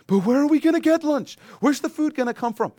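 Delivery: put-on high, loud child's whine; whiny child. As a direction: whine like a hungry kid. Child voiced asking parent.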